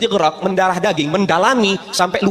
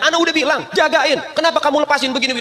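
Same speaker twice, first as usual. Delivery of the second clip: scolding; 7 semitones higher than usual. Retold or imitated.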